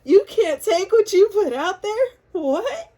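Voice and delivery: mock the idea in giggling disbelief; amused, squealing can't-believe-it; laughing disbelief. Real, not acted. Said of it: "I'm just laughing at the energy".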